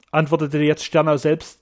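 plain reading voice

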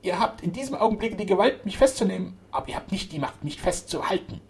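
high-pitched indignant declaration; proud defiance. A narrator's reading. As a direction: proclaim it like a defiant queen. Zaba defies her arrest.

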